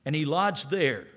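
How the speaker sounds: loud, high, ringing sermon voice; full-force revival preaching. Acted. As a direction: preach the story out to the whole church. Preaching the Elijah story.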